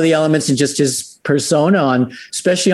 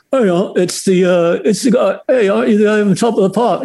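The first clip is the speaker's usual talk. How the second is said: put-on high, fast London cabbie accent; English cabbie accent; mock English accent; 7 semitones higher, faster than usual. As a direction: do an old London taxi driver, tongue in cheek. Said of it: "in my really great English accent".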